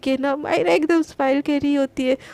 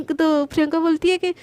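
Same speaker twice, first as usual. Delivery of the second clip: usual speaking voice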